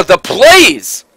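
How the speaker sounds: strained, loud hype shout; excited exclamation. Real, not acted. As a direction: cry out the plays with a worn voice. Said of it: "too much for my little voice today".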